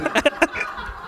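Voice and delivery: high squeaky burst of laughter; a high breathy laugh at the absurd idea. Real, not acted. Laughing at imagined Bezos claim.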